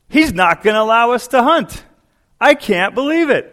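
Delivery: pretending the rancher refused, loud and high; mock indignant rant. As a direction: act worked up to fool his friend. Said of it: "Mickey Mantle gets all worked up".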